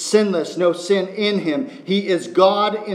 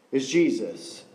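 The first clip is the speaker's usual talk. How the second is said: ordinary delivery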